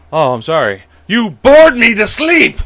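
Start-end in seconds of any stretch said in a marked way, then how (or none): 1.1-2.6 mock exasperated shout. Dad mock-complains daughter is boring.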